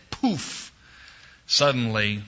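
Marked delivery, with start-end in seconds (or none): 0.1-0.7 puffed-out poof; a sharp, high magic poof; magic poof sound. Retold or imitated. Magic wish granted.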